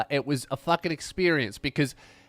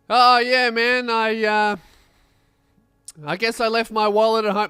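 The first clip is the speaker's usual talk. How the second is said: louder, casual don't-care excuse; careless teen shrug; 5 semitones higher, 7 dB louder than usual. Retold or imitated. Schoolkid without a ticket, louder casual excuse. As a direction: brush it off, no effort to lie. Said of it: "He just goes".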